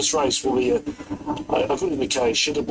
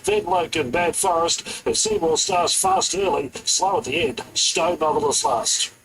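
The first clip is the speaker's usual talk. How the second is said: building live race commentary; race caller; 7 semitones higher than usual. Acted. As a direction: call the race as the finish nears. Live race call at Eagle Farm.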